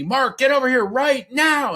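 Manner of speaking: high, loud urgent yell; Rocky. Retold or imitated. Manager calling him across the convention, imitated yell. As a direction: imitate a frantic summoning shout. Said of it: "I hear Rocky yell to me".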